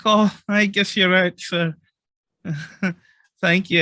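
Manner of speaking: high, bashful thanks with a giggle; shy shopkeeper. Acted. Shopkeeper Kana accepting money from the large man, bashful. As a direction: accept sheepishly, a little flustered. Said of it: "She's like".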